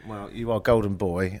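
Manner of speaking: ordinary talk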